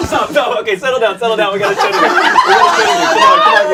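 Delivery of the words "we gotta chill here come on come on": squealing laugh. Real, not acted. Laughing while calming crowd.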